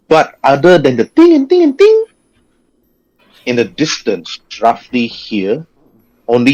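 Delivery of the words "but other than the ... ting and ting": high and loud, repeated ting. Shown as a demonstration. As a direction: ring out the tings high. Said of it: "you hear ting".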